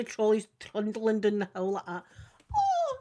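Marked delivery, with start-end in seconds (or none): 2.5-2.9 high mock scream; a high yelp, half laughing at herself; imitating her own high-pitched scream; retold scream. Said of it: "I'm screaming".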